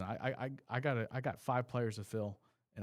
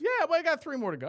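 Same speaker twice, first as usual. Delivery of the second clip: excited; 7 dB louder, faster than usual. Real, not acted. Defending his spending.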